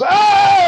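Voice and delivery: high loud mock yelp of pain; retold yelp. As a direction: yelp high as hot wax hits. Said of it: "and he goes".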